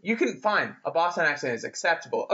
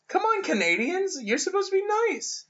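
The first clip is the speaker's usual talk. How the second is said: mock exasperation. Real, not acted. Mock scolding Canadians.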